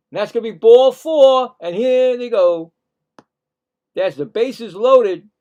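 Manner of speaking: excited home run call. Real, not acted.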